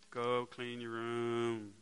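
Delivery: dad giving up with a slow, weary order; slow, tired order. Acted. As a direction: drag it out, worn down. Dad's flat final order.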